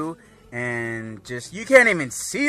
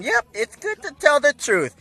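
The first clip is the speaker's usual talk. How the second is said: much higher, sing-song moral; prim Whis; 12 semitones higher, 4 dB louder than usual. Retold or imitated. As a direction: deliver the lesson daintily.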